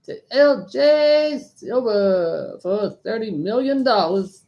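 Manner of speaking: high announcer voice calling sale; auctioneer announcement. Acted. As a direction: announce the winner grandly.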